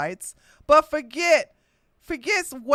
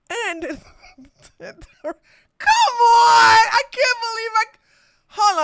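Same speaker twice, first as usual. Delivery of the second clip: loud, high laughing cry; cracking up mid-sentence; breaking into laughter; 11 semitones higher, 6 dB louder, slower than usual. Real, not acted. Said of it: "I keep breaking character".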